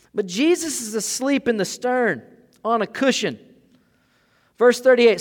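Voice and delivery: loud, high, driving sermon voice; full-force preaching. Acted. Preaching the storm story.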